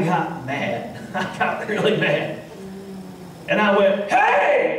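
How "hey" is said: sudden high shout of hey; yelled hey. Retold or imitated. Preacher retelling how he yelled at the drinking man, sharp shout. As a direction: yell to stop the man cold. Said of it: "And I yelled at him".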